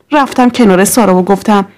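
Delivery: plain reading voice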